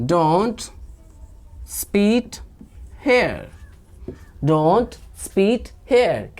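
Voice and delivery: very slow, separated words; word-by-word dictation. Shown as a demonstration. Teacher giving learners the English translation, word-by-word dictation. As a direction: spell out the phrase word by word.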